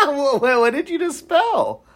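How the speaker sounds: laughing. Real, not acted. Reacting to spelled word.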